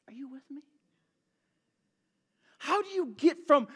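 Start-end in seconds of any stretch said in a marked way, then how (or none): 0.1-0.6 whispered to the congregation. Real, not acted. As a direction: lean in and whisper it. Preacher checking in with congregation.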